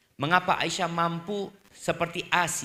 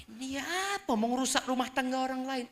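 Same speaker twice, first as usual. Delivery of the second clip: higher, scandalised gossiping voice; mock gossip; 10 semitones higher than usual. Gossiping people scolding a matchmaking father, mock and high. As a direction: gasp it like a nosy neighbour.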